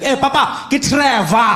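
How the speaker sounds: put-on loud, high-pitched accented interjection; irritated friend. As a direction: cut in, exasperated, in the friend's accent. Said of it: "my friend who was irritated".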